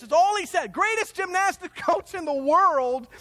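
normal talking voice

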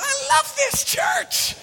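very high, loud, drawn-out squeal of delight; thrilled shout. Retold or imitated. Teen thrilled by a fight in church, gleeful shout. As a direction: shout it out in hammed-up glee.

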